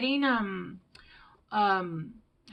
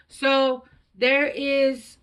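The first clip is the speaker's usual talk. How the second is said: louder and slow, almost yelling; 5 semitones higher, 8 dB louder, much slower than usual. Real, not acted. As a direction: she catches herself being too loud. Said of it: "I feel like I'm yelling".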